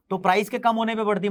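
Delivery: usual speaking voice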